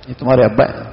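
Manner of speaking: "تمہارے" leads, "ابا" kept low; low statement. Shown as a demonstration. Preacher showing the child another meaning, low and matter-of-fact on ابا. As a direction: keep "ابا" low, no lift at all. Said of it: "چھ مطلب ہو سکتے ہیں".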